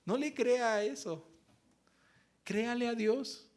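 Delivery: firm encouragement. Retold or imitated. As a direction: insist with rising conviction. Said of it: "Y yo le dije".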